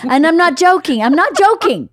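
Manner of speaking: high, loud insistence; emphatic. Real, not acted. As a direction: drive the point home twice. Emphatic repeat.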